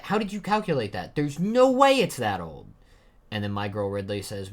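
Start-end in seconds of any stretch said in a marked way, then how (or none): 0.0-2.7 high, loud incredulous outburst; loud disbelief. Acted. [name] challenges the age estimate, in loud disbelief. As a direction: protest in shock, pitched way up.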